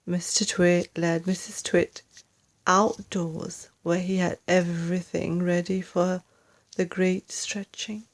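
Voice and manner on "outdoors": plain reading voice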